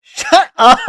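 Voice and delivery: loud laughing protest; laughing shout. Real, not acted. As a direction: yell it through laughter.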